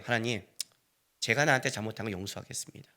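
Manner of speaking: low, soft yielding voice; submissive prayer. Acted. Preacher telling God he will forgive, submissive prayer. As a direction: give in quietly, as in private prayer.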